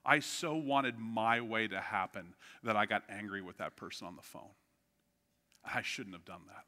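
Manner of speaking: low, soft retold confession; subdued, remorseful admission dropping in volume; sobered. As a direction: admit it quietly, sinking in regret. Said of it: "God had sobered me".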